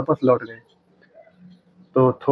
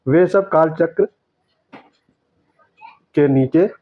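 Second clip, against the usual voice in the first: slow corrected reading; 2 semitones higher than usual. Shown as a demonstration. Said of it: "ये होना चाहिए था".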